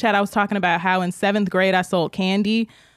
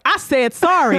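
higher, mock-indignant retort; imitated defiant bully; 6 semitones higher than usual. Rosa gets up to answer dad, mock defiant. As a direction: throw the apology back, lightly hammed-up.

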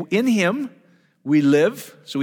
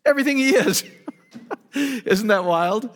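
high, laughing delight; 8 semitones higher than usual. Real, not acted. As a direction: laugh through it, thrilled by the idea. Preacher delighted by his point.

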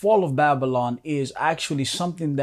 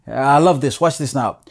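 ordinary delivery

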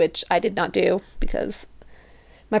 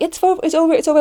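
high, loud imitation of friends answering; raised mock-frantic reassurance; urgent reassurance; 8 semitones higher, 6 dB louder, much faster than usual. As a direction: call it back rapidly to a panicking friend. Friends answering her panicked question.

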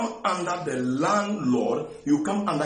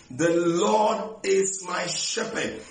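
slow emphatic; slower than usual. Acted. Repeats the psalm slowly.

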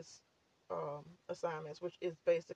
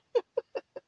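laugh; 8 dB louder than usual. Real, not acted. Laughs at own censoring.

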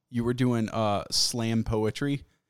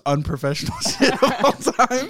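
laughing; 3 semitones higher, 6 dB louder than usual. Retold or imitated. Retold own remark, laughing.

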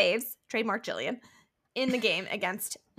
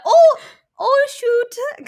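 much higher and louder, a jokey Canadian "oh, shoot"; mock Canadian accent; 14 semitones higher, 14 dB louder, much slower than usual. Swapping the swear for a Canadian version, hammed-up Canadian accent. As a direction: squeak it out like a polite Canadian. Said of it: "How would Canadians do it?".

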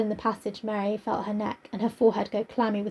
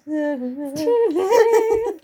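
slow and higher, laughing through it; laughing drawn-out repeat; 7 semitones higher, 3 dB louder, much slower than usual. Pretend. Repeating the landlord's line for a laugh, laughing. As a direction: stretch each word while giggling.

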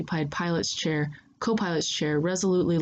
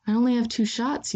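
nonplussed; 6 semitones higher than usual. A narrator's reading. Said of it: "you say, a little nonplussed".

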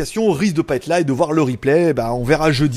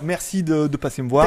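normal talking voice

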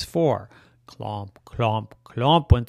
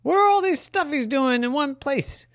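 high surprised voice; 13 semitones higher than usual. Acted. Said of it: "And the humans said".

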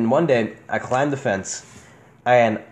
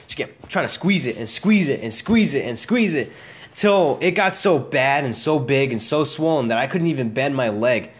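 ordinary talk

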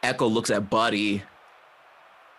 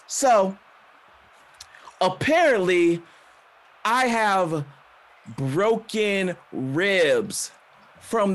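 higher, slow, pointed delivery; air-quote sarcasm; 5 semitones higher, slower than usual. Acted. Wrestler reports what the doctors said about her ribs, scornfully sceptical. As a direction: set words apart in scornful air quotes, with long pauses. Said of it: "saying that all in quotations".

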